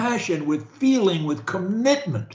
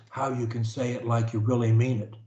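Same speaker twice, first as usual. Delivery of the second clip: quick, soft, rushed through; throwaway mumble; 8 semitones lower, 4 dB quieter, faster than usual. Shown as a demonstration. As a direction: toss it off without meaning it. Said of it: "See the difference?".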